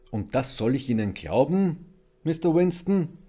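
loud, incredulous questioning; doubtful. A narrator's reading. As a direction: challenge him in open disbelief. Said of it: "fragte Constable Jones zweifelnd".

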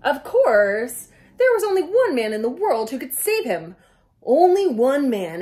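loud, dramatic announcer build-up. A narrator's reading. Reader builds up the hero for the kids, loud and dramatic. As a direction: boom it like a trailer voice.

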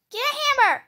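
loud, high scared yell; panicked shout. Real, not acted. Panicking in horror game.

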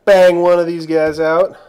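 loud, high burst of excitement; excited shout. Real, not acted. Gamer shouting at the screen as the move lands, loud excited yell.

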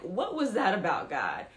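a hint of bewildered asking; puzzled question to God. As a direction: lightly wonder aloud what God meant. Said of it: "And I kinda said it like".

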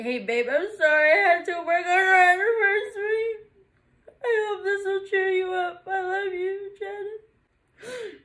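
high, crying read-aloud; happy tears. Real, not acted. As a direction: read the note while breaking down in tears. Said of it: "These are happy tears".

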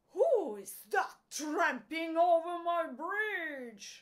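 loud, high-pitched storybook roar; troll's roar. A narrator's reading. Troll challenging the big goat, full loud roar. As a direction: bellow it as an outraged troll. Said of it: "roared the troll".

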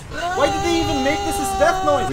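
high-pitched exasperation; exasperated complaint. Real, not acted. Player griping at the death noise, exasperated.

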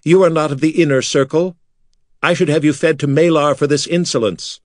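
high-pitched indignant demand; a high priest outraged at the intruder; angry demand. Acted. Priest Makar confronting the intruder, high indignant demand.

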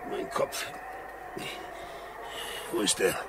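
tonlos gestöhnt nach dem Schlag; leise, benommen aufwachen. Acted. Kommt benommen zu sich. (benommenes Stöhnen)

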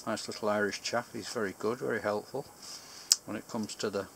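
speaking with pipe stem in mouth; talking around a pipe. Real, not acted. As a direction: pipe clenched in teeth. Seen 4: Pipe between lips 0.3–1.6 and again 6.2–10.1; removed between.

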